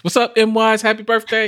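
high, held singsong voice; mock birthday greeting. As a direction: call it out in a silly high pitch. Acted greeting to a nicknamed colleague.

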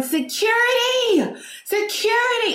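drawn-out, high-pitched cry for security; high-pitched yell. Retold or imitated. Shopper screaming for store security, high-pitched yell. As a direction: yell it long and high, to the top of your head. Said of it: "So I'm yelling".

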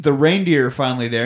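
ordinary delivery

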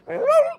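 high, whining puppy cry; imitated whining dog; dog whimper. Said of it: "That's a good dog sound".

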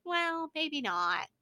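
drawn-out mock hesitation; slow mock officials. Mocking the school's stalling, slowly. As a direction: stretch it slowly like a smug official. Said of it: "they were just like".

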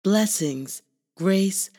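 measured, reverent poem reading with long pauses; slow spoken-word affirmation. Acted. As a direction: read it like a calm blessing. Spoken-word affirmation over music.

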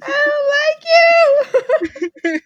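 high squeal through laughter; laughing too hard to speak; helpless laughter. Real, not acted. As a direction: squeak it out while cracking up. Cracking up at friend's pun.

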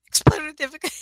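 high, loud laughing speech; real laughter breaking up the words. Real, not acted. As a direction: try to talk while cracking up. Cracking up over her retold expletive.

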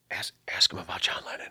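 hushed whisper to himself; whispered thought. Retold or imitated. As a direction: whisper it like a secret inner urge. Inner thought, back of my head.

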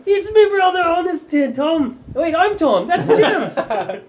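high, loud, flustered character voice; Honest Tom. Acted. Tom mixing up his own name, high and flustered. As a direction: ham it up high and loud, close to laughing. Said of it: "And Honest Tom's like".

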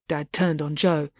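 ordinary delivery

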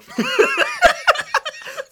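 big burst of laughter; loud laughing burst. Real, not acted.

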